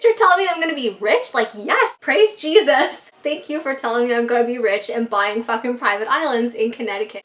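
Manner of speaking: overjoyed, praising the heavens; thrilled. Retold or imitated. Thrilled about getting rich.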